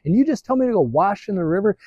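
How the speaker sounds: high, indignant voice of a proud commander; arrogant Naaman. Acted. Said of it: "that's really what Naaman is saying".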